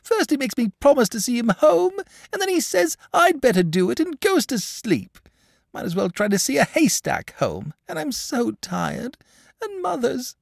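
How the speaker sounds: acted sobbing child, very high wail; high wailing complaint that breaks off; tearful breakdown. A narrator's reading. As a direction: pour out the grievance as a weepy little boy. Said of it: "Here he broke down again".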